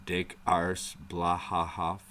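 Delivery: ordinary talk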